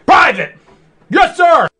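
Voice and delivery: barked commands and shouted replies; drill sergeant. Acted. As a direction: shout orders like a boot camp drill. Military roleplay, barked commands.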